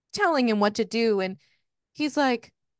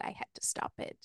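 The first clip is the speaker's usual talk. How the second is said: whispered; 15 dB quieter, faster than usual. Retold or imitated. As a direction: drop to a breathy whisper on the last line. Retold confession, dropped to whisper.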